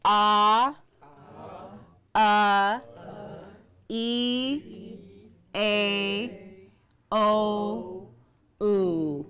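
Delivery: "Ah, uh, E, A, O, oo" each held separately; vowel drill. Shown as a demonstration. Teacher leading a class through the vowels, drill of "Ah, uh, E, A, O, oo". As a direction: chant out "Ah, uh, E, A, O, oo" with pauses between. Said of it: "those are our vowels".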